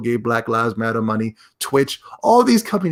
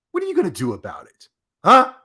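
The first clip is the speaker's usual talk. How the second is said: challenging huh; 6 semitones higher, faster than usual. Real, not acted. Demanding answer from clip.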